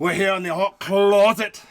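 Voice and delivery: loud, hammed-up Irish accent. Host introduces the podcast to giggles, mock Irish accent. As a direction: announce it in a jokey, bad Irish brogue. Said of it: "This is my Ireland accent".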